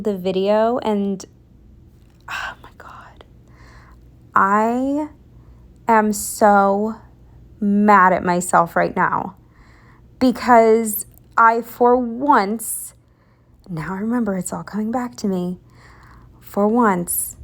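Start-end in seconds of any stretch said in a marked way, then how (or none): 2.3-3.2 breathless horrified whisper; dismayed whisper. Real, not acted. Dawns she redid it twice. 13.7-15.6 fast whisper to herself; hurried whisper. Real, not acted. Frustrated rant remembering mistake.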